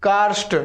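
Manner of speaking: "कार्स्ट" with half r and half s, full t at the end; "कार्स्ट" as kaa-r-s-t, ending in a full t; careful pronunciation. Shown as a demonstration. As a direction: sound every part of "कार्स्ट": kaa, r, s, t. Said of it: "का, फिर आधा र, फिर आधा स और फिर ट".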